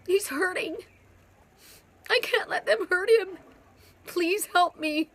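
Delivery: retold anguished plea; desperate plea. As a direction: beg them for help. Pleading to archangels for twin.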